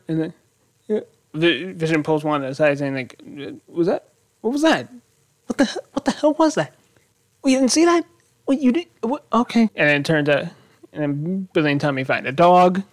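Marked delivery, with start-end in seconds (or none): 3.7-9.7 much higher, startled voice, lightly acted; baffled. Husband asking his wife about a strange neighbour, in a high startled voice. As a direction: play the husband who can't believe what he saw. Vision pulls Wanda aside.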